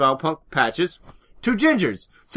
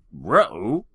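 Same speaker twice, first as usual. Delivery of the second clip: low Scooby-Doo style ruh-roh; a goofy cartoon-dog uh-oh; 6 semitones lower than usual.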